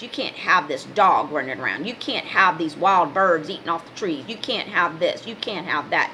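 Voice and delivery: lightly voiced rule-maker, low and listing; homeowners' association scold. Mimicking the HOA telling her what she can't have, mild scolding.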